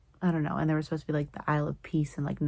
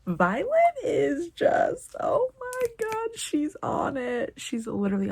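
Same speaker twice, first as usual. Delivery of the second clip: high, drawn-out fangirl squeal; overwhelmed high-pitched gushing; gushing excitement; 10 semitones higher, 4 dB louder, slower than usual. Real, not acted. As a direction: squeal it slowly in awed delight. Reader gushing over character's cleverness.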